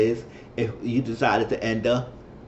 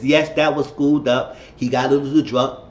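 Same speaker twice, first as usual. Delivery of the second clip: ordinary delivery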